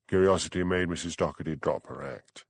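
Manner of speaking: ordinary delivery, nothing marked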